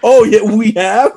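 loud, amused feigned surprise; playful loud disbelief; mock surprise. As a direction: act like it's news to you, half laughing.

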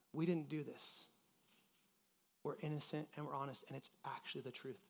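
hushed, a touch unsure; quiet denial. Retold or imitated. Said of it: "And for the first time, they can say".

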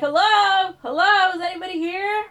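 loud, high call for anyone around. Retold or imitated. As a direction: call out to see if someone's there. Calling out in empty hotel lobby.

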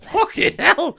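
high, loud shocked outburst; shocked exclamation. Real, not acted. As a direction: yelp it out in shock. Shocked reaction.

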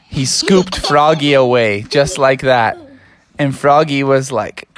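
plain reading voice

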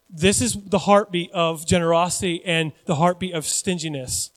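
ordinary talk

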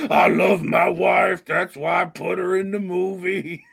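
mock-earnest [name] impression. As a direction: proclaim it like a hammed-up [name]. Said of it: "What other wholesome [name] things".

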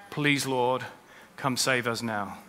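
soft prayer. Acted. Preacher modelling the congregation's own soft plea to God. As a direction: pray it quietly and earnestly. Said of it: "we need to cry out to God".

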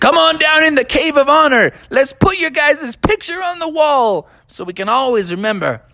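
high, put-on celebratory creature voice; old wise rhinoceros. Old rhinoceros congratulating the golfers, jubilant. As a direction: proclaim it as a delighted old rhinoceros. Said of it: "the old wise rhinoceros".

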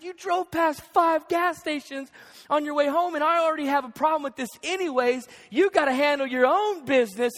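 exasperated retort, retold with force; mock arguing. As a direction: act out a husband firing back in a quarrel. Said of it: "we're arguing back and forth".

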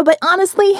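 plain reading voice

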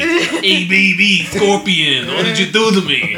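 mock Kabal in Brooklyn accent. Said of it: "heavy Brooklyn accent".

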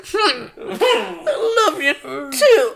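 drawn-out, high, half-asleep murmur with laughter; mock dozing off. Tipsy man answering girlfriend's goodnight, comically drowsy. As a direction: play at being nearly asleep, very slow.

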